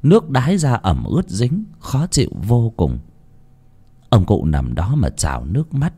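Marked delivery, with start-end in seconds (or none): none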